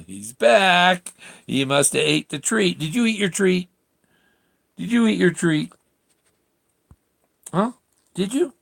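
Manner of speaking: high, lilting pet voice. Real, not acted. Owner greets dog Jack coming back, high baby talk. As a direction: fuss over the dog up high.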